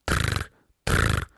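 two short rolled trills; beetle trill. Acted. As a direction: buzz twice like an insect. Beetle answers no.

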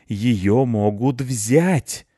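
very slow, drawn-out warning; ominous. Acted. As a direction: stretch each word like a dark prophecy. Cat warns ominously.

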